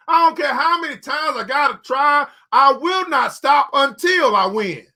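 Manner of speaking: ordinary talk, nothing marked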